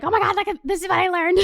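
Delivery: high, gushing mock excitement with a laugh; excited friend. Telling friends about zodiac research, high excited gush. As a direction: spill it like a thrilled nerd. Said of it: "you just wanna tell your friends".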